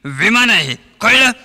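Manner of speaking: comic actor yelling at his co-star on the plane; mock shouting. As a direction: bark it at Chimanrao like a bus conductor. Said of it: "ओरडू नका निळू भाऊ".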